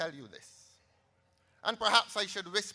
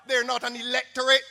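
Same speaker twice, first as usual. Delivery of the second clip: ordinary delivery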